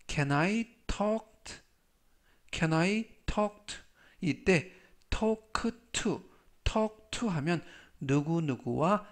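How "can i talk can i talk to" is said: very slow, each word drawn out; slow demo reading. Shown as a demonstration. English teacher reading slowly to students, drawn out. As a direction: read it slowly for learners to copy. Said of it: "Can I 뭐뭐 해도 돼?".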